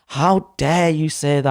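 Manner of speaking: imitating a tearful, indignant manager; angry and crying. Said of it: "she's angry with, like, crying".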